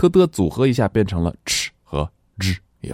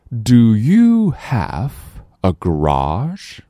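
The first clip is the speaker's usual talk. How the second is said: slow question rising on "garage"; slow model question; 5 semitones higher, much slower than usual. Shown as a demonstration. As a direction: read it slowly for the class to repeat. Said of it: "我们再来大声朗读". (慢速示范朗读问句)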